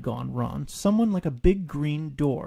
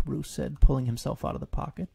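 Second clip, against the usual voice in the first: ordinary delivery, nothing marked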